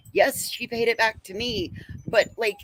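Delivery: ordinary talk, nothing marked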